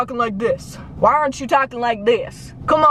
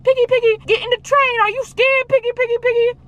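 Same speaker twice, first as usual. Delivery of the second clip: creepy pig men; 12 semitones higher than usual. Retold or imitated. Pig men taunting visitors on the train, high creepy sing-song. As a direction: taunt the scared kids like a pig man. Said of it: "And they're like".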